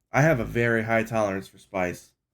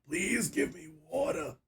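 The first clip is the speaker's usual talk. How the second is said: mock hoarse plea for water, higher and soft; parched plea; 8 semitones higher, 7 dB quieter than usual. Voicing his dry throat begging for water, faint and higher. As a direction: croak it like a thirsty throat.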